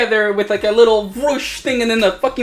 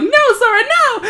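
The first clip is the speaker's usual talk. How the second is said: very high squeaky pleading no; Mickey voice. Retold or imitated. Joking plot recap to a friend, voices a squeaky high Mickey.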